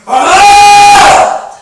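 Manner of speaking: big shouted roar, high and loud; a huge playful lion roar. Pretend. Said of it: "사자가 어떻게 울어?".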